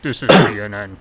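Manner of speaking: deep, loud news anchor voice; mock CNN announcer; parody news anchor. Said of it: "I gotta clear my throat so I have a deeper news anchor voice".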